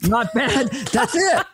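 normal talking voice

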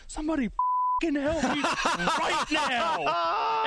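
retold outburst, slow and high; imitating wife's yell. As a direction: stretch every word of the demand. Wife mid-contraction demands help.

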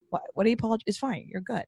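hushed, soothing imitation of reassuring someone; quiet reassurance. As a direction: gently wave away a needless apology. Imagined reassurance to the apologetic mom.

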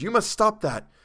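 high, loud, insistent command; urgent admonishing. A narrator's reading. Narrator shaking a crazed Chalmers, admonishing him urgently. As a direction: press it on a panicked friend.

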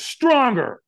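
high-pitched retold command; trainer's demand. Trainer demanding a bigger yes from firewalker, insistent. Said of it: "And he screamed at me".

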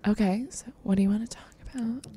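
quick, whispered creep voice; creepy caller. Retold or imitated. Imitating the caller to her friend, creepy whisper. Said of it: "he sounds so creepy".

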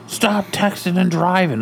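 high, slow mocking nag; mocking scold. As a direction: sneer it like a preachy stranger. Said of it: "and she's like".

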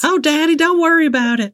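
put-on high southern teen voice; southern accent. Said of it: "my southern accent".

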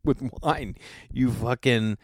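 usual speaking voice

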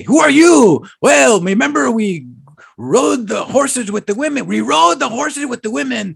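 high-pitched, hammed-up movie bandit yell; mock bandit outburst. Quoting the bandits to a friend, high mock yell. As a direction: call it out high, imitating the film. Reenacting the bandit exchange from film.

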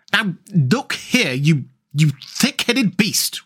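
slow, exasperated scolding; halting, spluttering anger without shouting; really angry. A narrator's reading. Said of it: "really angry".

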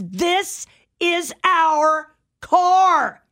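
slow, high, indignant insistence; mock-angry stranger claiming the car; angry retort. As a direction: drag each word out like a stubborn stranger. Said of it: "looks at her angry and says".

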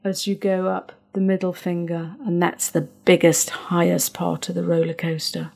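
soothing guided breathing; guided breathing script. Shown as a demonstration. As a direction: talk them calmly through each breath.

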